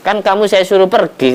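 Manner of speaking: high, reproachful scold; scolding Sulaiman. Acted. Sulaiman scolding the trapped hoopoe, high and reproachful. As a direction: tell him off for not leaving.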